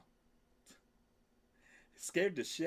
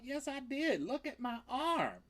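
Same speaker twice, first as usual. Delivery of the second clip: mock whiny protest; 11 semitones higher than usual. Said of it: "I'm like".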